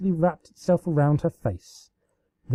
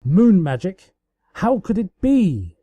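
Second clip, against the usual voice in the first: higher, louder mock shock; faux horror; 7 semitones higher, 4 dB louder than usual. A narrator's reading. Celestia pretending to be shocked by Luna. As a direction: gasp it in hammed-up horror. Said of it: "balked in faux horror".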